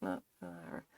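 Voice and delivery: hushed, can't-be-bothered noises; mock listless dog. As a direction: imitate an indifferent dog, mumbled under the breath. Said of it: "just being really kind of like".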